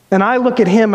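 normal talking voice

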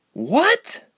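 high, loud disbelieving "What?"; incredulous cry. A narrator's reading. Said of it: "Ilse cried incredulously".

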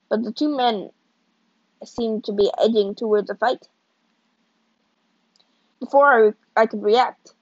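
plain reading voice

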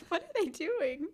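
normal talking voice

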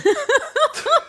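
shrill burst of laughter; high shrieking laugh. Real, not acted. Big laugh at joke.

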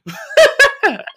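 big high burst of laughter. Real, not acted. Comedian laughing at his own teasing, high burst. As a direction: laugh out loud at yourself. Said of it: "I kinda was making fun of her".

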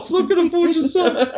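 high squeaky echo, laughing; mock Niels repeat. Friend echoes the bobblehead joke, laughing and squeaky. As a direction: repeat the toy voice through a laugh.

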